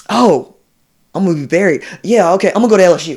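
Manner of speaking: put-on a light take on a hasty teen deciding; mock teenage recruit. Voicing young recruit's thoughts.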